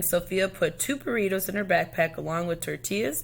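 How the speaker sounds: ordinary delivery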